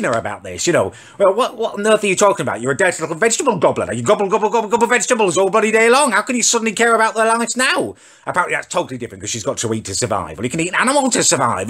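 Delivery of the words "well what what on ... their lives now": high, loud mocking rant at his sister; ranting confrontation. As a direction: tear into her, jeering. Confronting his sister about the tree.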